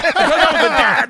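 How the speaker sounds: high, loud laughing punchline; laughing shout. Real, not acted. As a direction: yell the punchline through a laugh.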